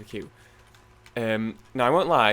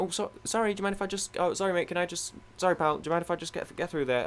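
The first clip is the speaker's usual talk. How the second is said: high, hurried string of sorries; apologetic; 7 semitones higher than usual. Retold or imitated. Traveller asking strangers to let them past, apologetic. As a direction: stumble politely over each excuse.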